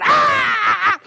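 high re-enacted scream; long, high "ah" yell; loud panicked scream. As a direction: holler out in mock panic. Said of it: "I guess I got loud".

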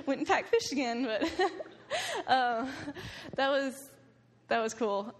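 high-pitched, laughing through the words. Real, not acted. Finishing the prayer story for the audience, laughing. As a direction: keep breaking up with laughter.

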